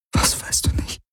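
voiceless, soft whisper; anxious whisper. Acted. She presses him after the kiss, anxious whisper. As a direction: whisper it, barely daring to ask. Nach dem Kuss fragt sie nach.

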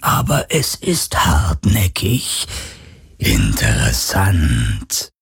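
low, very slow whispered questions; phantom voice. Acted. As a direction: probe him slowly like a curious ghost. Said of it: "fragte das Phantom".

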